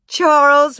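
loud, high mock scolding; amused protest. Ma protesting at Pa's singing, amused. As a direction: protest his name without meaning it. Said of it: "Ma protested, but she was laughing".

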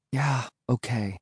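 soft, slow giving in; subdued concession; reluctant agreement. Acted. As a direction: agree at last, quietly and slowly. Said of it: "I agreed finally".

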